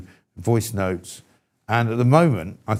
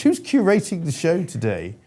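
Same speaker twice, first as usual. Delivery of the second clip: posh voice; 7 semitones higher than usual. Pretend. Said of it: "it's so posh".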